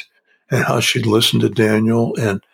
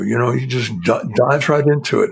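ordinary delivery

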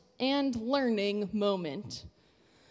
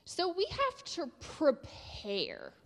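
put-on broad singsong kids theatre; 5 semitones higher, 4 dB quieter, slower than usual. Shown as a demonstration. Children's sermon, teaching phrase.

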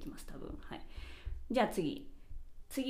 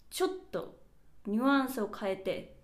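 ordinary talk, nothing marked

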